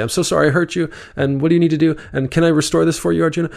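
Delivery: mock the apologizer who jumps in too fast; over-eager apology. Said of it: "Da, da, da, da".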